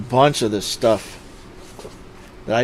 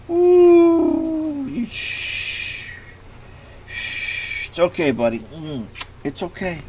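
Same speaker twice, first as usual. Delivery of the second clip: soothing a scared dog; 10 semitones higher, much slower than usual. Real, not acted. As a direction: shush and comfort him.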